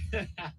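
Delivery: short laugh; a brief laugh after speaking. Real, not acted. Laughs after praising the trade.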